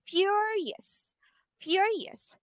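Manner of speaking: "furious" twice, each high on FU then dropping; first-syllable stress. Shown as a demonstration. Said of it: "the primary stress is on the first".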